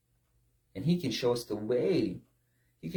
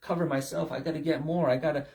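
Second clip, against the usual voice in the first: fast, pressured mock worrier; frantic gotta list; 2 semitones higher, faster than usual. Preacher mimics a burnt-out striver's racing thoughts. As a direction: pile on the worries breathlessly. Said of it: "you got to".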